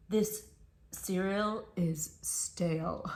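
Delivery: slow, half-whispered sniff; a picky raccoon sneers at breakfast; snooty raccoon. Acted. Raccoon complains about cereal, slow and breathy. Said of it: "he says to you".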